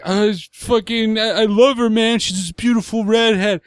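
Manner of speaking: crying voice. Retold or imitated. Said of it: "I'm trying to do crying voice".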